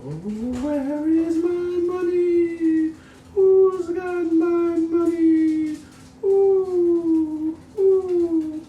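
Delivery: ghostly wail. Acted. Dead woman's ghost wants her money, high slow wail. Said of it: "the ghost of the dead woman cried".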